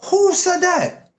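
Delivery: very high-pitched disbelief; drawn-out, squeaky astonished question; incredulous surprise. Real, not acted. Incredulous at a reported boast.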